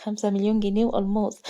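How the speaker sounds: ordinary delivery, nothing marked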